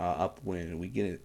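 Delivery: ordinary talk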